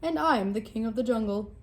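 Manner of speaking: booming king of the jungle, amused; mocking lion. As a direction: lord it over the little mouse. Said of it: "Lion roared with laughter".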